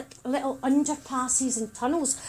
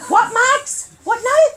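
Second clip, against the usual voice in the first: high loud disbelief; can't believe what she said; shrill-free loud baffled question; incredulous; 9 semitones higher, 10 dB louder than usual. Retold or imitated. Incredulous at sister's reply.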